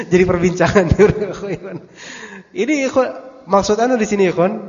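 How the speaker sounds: real chuckle through the words; amused laugh while talking. Real, not acted. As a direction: laugh as you say it. Laughing about the gossip.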